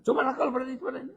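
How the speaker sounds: high taunting voice; preacher acting out a taunting wife; mocking dare. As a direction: goad him, go on if you dare. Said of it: "Dia kata".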